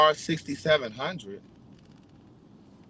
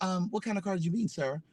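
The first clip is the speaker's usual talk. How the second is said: courteous reply; 3 semitones higher, 7 dB quieter than usual. Retold or imitated. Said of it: "He'd be like".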